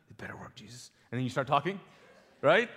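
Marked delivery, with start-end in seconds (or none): 0.1-0.9 breathless whisper, almost no voice; whispered prayer. Retold or imitated. Nervous man whispering a quick prayer to Jesus before speaking. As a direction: mutter it under your breath to God.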